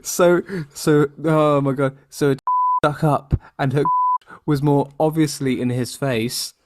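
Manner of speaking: real giggling while reading; laughing through it. Real, not acted. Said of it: "I can't".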